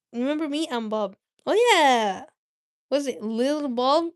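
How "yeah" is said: usual speaking voice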